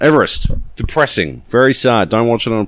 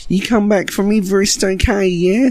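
high, hammed-up Kiwi accent put-on; mock Kiwi accent. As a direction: Keira Knightley's bad Kiwi accent, parodied. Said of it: "That was pretty good, actually".

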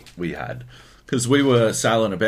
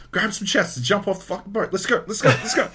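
high-pitched, hurried urging; excited mock-urgent rush; urgent egging on; 9 semitones higher, faster than usual. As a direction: egg the crew on, voice pitched up. Acted urging of crewmates mid-robbery.

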